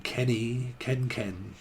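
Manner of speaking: usual speaking voice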